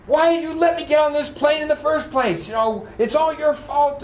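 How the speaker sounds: high, indignant outburst; loud, high-pitched blaming rant; mock furious yelling. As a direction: rail at the airline like an irate passenger. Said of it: "He gets more upset".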